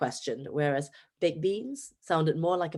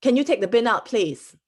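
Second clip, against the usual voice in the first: Singaporean English, take punched higher and louder; firm Singaporean request, a bit higher and louder; Singaporean accent; 5 semitones higher, 7 dB louder than usual. Retold or imitated. Young [name] asks her housemate to take the bin out, in a Singaporean accent. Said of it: "in my Singaporean accent".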